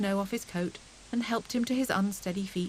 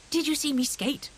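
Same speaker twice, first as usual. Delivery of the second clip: higher, eager question; excited; 6 semitones higher, 3 dB louder than usual. A narrator's reading. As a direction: boast about skating. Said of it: "asked Hare excitedly".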